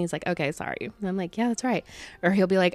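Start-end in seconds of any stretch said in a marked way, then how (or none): 0.3-0.8 much softer, small sheepish sorry; meek apology. Retold or imitated. Meek reply to a friend's scolding. 1.4-1.8 smug soft reply. Retold or imitated. Smug reply after friend apologises.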